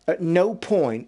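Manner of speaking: slow, NO stretched and lifted; stress on NO. Real, not acted. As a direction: hammer the word no. Emphatic point about never doing it.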